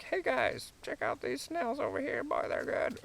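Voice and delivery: high, soft chatty turkey voice; turkey talking. Pretend. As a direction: voice a hen chatting to the flock, pitched high. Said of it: "it almost sounds like they're talking, like".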